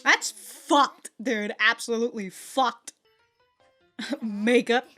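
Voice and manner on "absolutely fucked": ordinary talk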